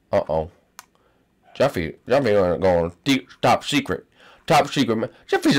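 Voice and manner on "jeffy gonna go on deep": ordinary delivery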